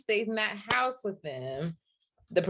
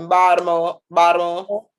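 loud Baltimore "Baltimore"; mock Baltimore accent; 9 dB louder than usual. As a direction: drop the r like someone from Baltimore. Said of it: "someone from Baltimore".

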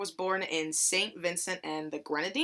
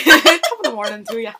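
laughing through a hammed-up Irish accent, louder; mock Irish accent; 4 semitones higher, 13 dB louder than usual. Greeting Irish listeners, mock Irish accent and laughing. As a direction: giggle out a corny Irish greeting. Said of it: "my horrible Irish accent".

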